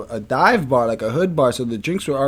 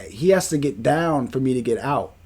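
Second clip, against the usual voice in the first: usual speaking voice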